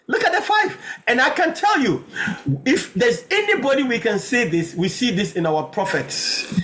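high, emphatic pulpit proclamation; preacher's voice lifted high; excited preaching. Real, not acted. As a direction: announce it triumphantly to the congregation.

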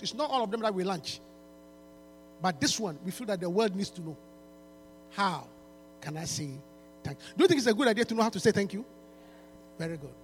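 ordinary delivery

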